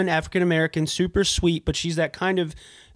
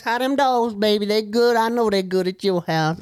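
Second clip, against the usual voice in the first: put-on high, chatty older lady's voice; thrift store lady; 5 semitones higher than usual. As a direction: fuss over a customer's dogs like a doting older lady. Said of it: "I like the thrift store lady voice".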